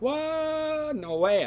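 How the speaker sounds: high, loud shocked yelp. Retold or imitated. Luke hearing the old man is Kenobi, shocked yelp. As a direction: burst out in disbelief.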